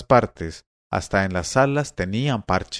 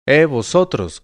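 louder, higher calling-out; shouting; 4 semitones higher, 5 dB louder, slower than usual. A narrator's reading. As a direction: call out to stop them. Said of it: "gritó".

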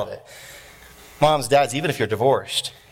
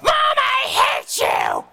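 very high, drawn-out screech; hammed-up teen tantrum shriek; angry teenager; much slower than usual. Teenager slamming the door on mom, screeching. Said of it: "Teenager comes in, slams the door".

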